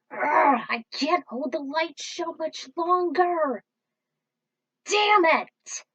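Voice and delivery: high, loud, slow and strained; straining cry. Acted. Crew member straining to hold the shield, then cursing. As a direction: groan under the strain, then curse.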